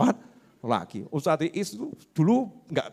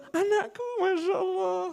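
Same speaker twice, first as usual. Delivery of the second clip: cooing parent; 5 dB quieter than usual. Acted. Patient passenger cooing at child.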